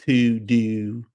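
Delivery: TO DO with long Baltimore vowels; Baltimore accent. Shown as a demonstration. Host shows how you say it in a Baltimore accent. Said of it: "that's how you say it".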